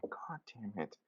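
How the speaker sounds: exasperated whispered swear; muttered curse. Retold or imitated. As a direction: curse it under your breath. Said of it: "I'm like".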